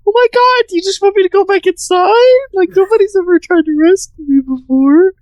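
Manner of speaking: mock crying in a very high voice; exaggerated weepy falsetto, playing at being overcome; Harley. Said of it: "She starts crying".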